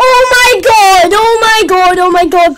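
high, loud cries easing off; alarmed shouting. Real, not acted. Player shocked by a baby zombie, shouting. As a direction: repeat it in rising-panic style, starting at the top. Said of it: "sorry if I'm shouting major bad".

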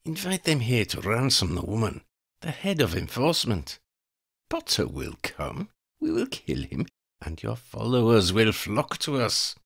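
high, cold voice of a female vampire plotting murder; scheming vampire woman. Acted. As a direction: savour each threat like a predator. Elena lays out plan.

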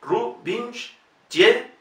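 Rubinstein very slow, sh and ye sounds; Rubinstein, slow, -shtein; slow sh demo. Shown as a demonstration. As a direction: stretch Rubinstein syllable by syllable. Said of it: "se pronuncia sh".

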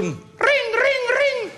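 high ringing doorbell imitation. Imitating the doorbell ringing at the front door. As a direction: ring like a shrill doorbell.

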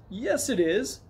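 put-on high, insistent boyish retort; confident. A narrator's reading. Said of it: "the boy said confidently".

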